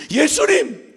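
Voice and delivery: high, loud call; a desperate shout of the name. Retold or imitated. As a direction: call after him. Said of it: "멈출 수가 없어서".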